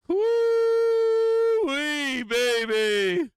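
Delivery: high, loud whooping cheer; woo-wee whooped out high and loud; excited whoop. Real, not acted. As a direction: crow over the winning trade. Celebrating the market drop live.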